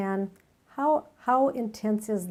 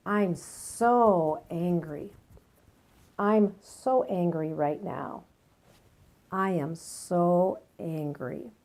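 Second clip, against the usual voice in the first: slow and deliberate, leaning on "so"; slow emphatic affirmation. Shown as a demonstration. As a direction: repeat it slowly, pressing hard on "so" each time. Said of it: "I would use this kind of approach".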